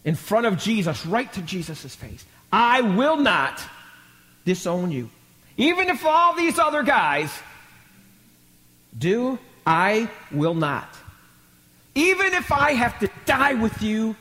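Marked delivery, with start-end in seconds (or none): 2.5-14.1 high, forceful declaration with weighty pauses; preacher acting an adamant disciple; emphatic. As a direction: proclaim the vow defiantly. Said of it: "He emphatically, in front of the brothers".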